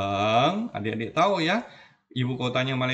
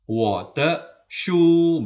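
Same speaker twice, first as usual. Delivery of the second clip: slow loud model; 5 semitones higher, 6 dB louder, much slower than usual. Shown as a demonstration. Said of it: "teacher reads example sentence aloud".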